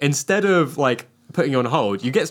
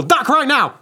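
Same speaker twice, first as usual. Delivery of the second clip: high urgent shout; shouted command; 13 semitones higher, 5 dB louder, slower than usual. Pretend. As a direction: yell it like a game prompt. Phone yells a command.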